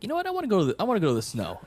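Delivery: high-pitched burst on an imagined whim; mock whimsical excitement. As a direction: pipe up high, playing someone with a sudden urge.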